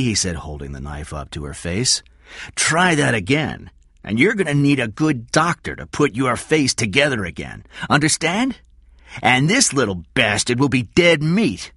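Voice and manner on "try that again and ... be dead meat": high, loud, forceful threat; deliberate menace, voice raised; threatening Cy. Acted. Cy holds knife to Kathy, threatening.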